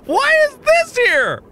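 very high, squealed disbelief; exasperated high-pitched incredulity; flabbergasted outcry. Real, not acted. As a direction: cry out in total bafflement. Outburst of disbelief at game puzzle.

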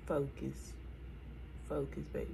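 softly coaching herself; quiet self-encouragement; self-talk. Real, not acted. Vlogger softly telling herself to focus. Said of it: "telling herself".

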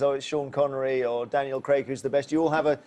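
ordinary talk

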